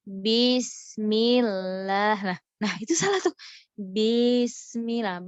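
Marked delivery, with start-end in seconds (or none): 0.1-2.1 "Bismillah" with the short i after b dragged long, slow; stretched ba. Shown as a demonstration. Teacher demonstrating the stretched ba mistake. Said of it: "dipanjangkan baknya". 3.7-5.3 "Bismillah" with the short i after b dragged long, slow; stretched ba. Shown as a demonstration. Teacher demonstrating the stretched ba mistake. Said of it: "Baknya dipanjangin".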